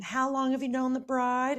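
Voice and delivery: high put-on voice of a woman at a wedding; high mock voice. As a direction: squeak it out like a nosy guest. Mom retells woman's question.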